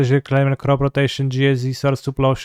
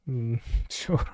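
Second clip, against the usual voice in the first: chuckling; 9 dB quieter than usual. Real, not acted. Chuckling at the play.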